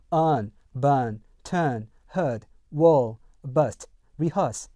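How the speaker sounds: "turn" and "heard" with R colour removed; British word list, "bun, turn, heard" without any R. Shown as a demonstration. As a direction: say "turn" with a plain schwa-like vowel, no R. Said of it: "R 색채를 없애겠죠".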